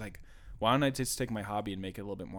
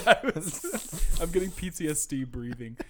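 laughing; 7 semitones higher, 6 dB louder than usual. Real, not acted. Laughing at own breathing trouble.

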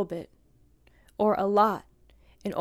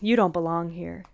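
eye-rolling dismissal; 9 dB louder than usual. Acted. Teacher mocking a snub to a Bikram teacher at the workshop, dismissive. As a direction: roll your eyes audibly on a big scornful "Oh". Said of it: "I don't roll my eyes and go".

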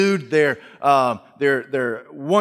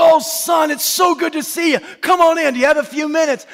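much higher, loud, overjoyed; light up at the sight of her son; delighted mother; 13 semitones higher, 5 dB louder than usual. Acted. Mother welcoming her tired son at the door, overjoyed.

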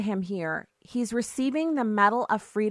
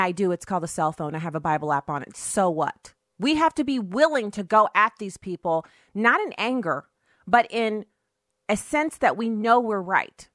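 usual speaking voice

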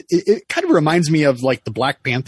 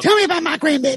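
high, sweet granny voice; high grandma voice; faster than usual. Retold or imitated. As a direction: play an eager grandma. Voicing grandma, high pitched.

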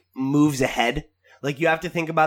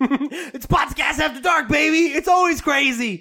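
mock hype; 8 semitones higher than usual. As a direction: whoop up the after-dark show. Hyping the show's late-night chaos.

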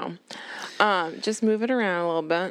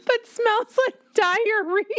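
laughing; slower than usual. Real, not acted. Cracking up over gross joke.

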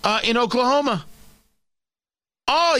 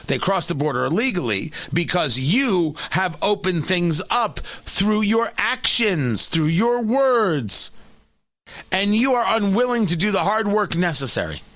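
ordinary talk, nothing marked

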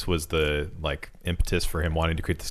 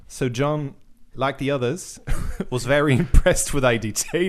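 put-on British accent sliding toward New Zealand; higher-pitched, badly held British accent, amused; wandering British accent; 4 semitones higher than usual. Host retries the paragraph for friends, wobbly British-New Zealand accent. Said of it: "Now I'm just New Zealand".

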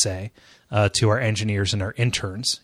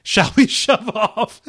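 laughing while talking; 9 semitones higher, 3 dB louder, slower than usual. Real, not acted. Laughing through the words.

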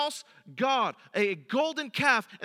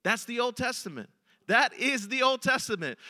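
normal talking voice

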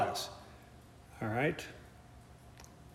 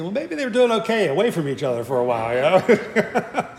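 amused inner thought; 3 semitones higher than usual. Retold or imitated. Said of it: "And I was thinking".

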